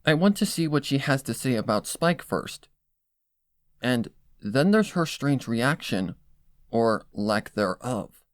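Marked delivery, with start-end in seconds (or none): none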